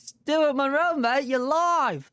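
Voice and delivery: high, loud announcement, last words drawn out; excited greeting. Real, not acted. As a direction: welcome the caller on air with a big whoop. Host greets live caller excitedly.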